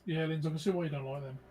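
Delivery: talking with a mouthful of chicken; chewing between words; eating crunchy fried chicken. Real, not acted. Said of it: "I'm eating chicken".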